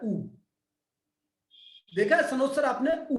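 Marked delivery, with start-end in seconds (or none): 0.0-0.2 ऊ sung out as a long oo; the sound oo. Shown as a demonstration. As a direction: say ऊ as a drawn oo. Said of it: "छोटा सा ऊ होता है". (ऊ की ध्वनि बोलकर दिखाना)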